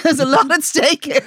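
high-pitched sarcasm through laughter; sarcastic. Real, not acted. Friend mocking a furious pharmacy rant, sarcastic. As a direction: tease with mock gravity, laughing. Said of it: "You're being very sarcastic now".

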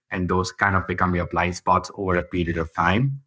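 ordinary talk

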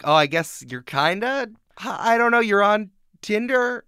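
awkward mock question. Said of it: "you're like".

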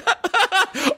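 squealing high laugh. Real, not acted. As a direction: burst into laughter. Laughing at whale charcuterie.

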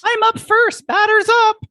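very high playful squeal; squeaky excited. Acted.

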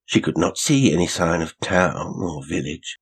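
ordinary delivery, nothing marked

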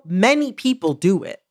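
ordinary talk

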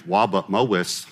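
"Wabut Mowis" with w sounds in place of r; childlike "Wabut Mowis", w replacing each r; says w for r. Retold or imitated. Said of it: "I couldn't pronounce my R's".

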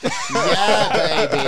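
loud, high-pitched catchphrase in mock-British; exaggerated, laughing British impression; Austin Powers. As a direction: belt out a swinging British spy catchphrase. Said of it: "turn into Austin Powers".

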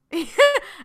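high, loud real laugh bursting out; burst of laughter. Real, not acted. Reacting to a funny quote.

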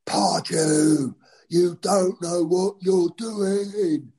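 put-on high, slow drunken yell of the name; drunken heckle. Drunk fan heckling the manager from the stands, slow high yell. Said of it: "stood up and shouted".

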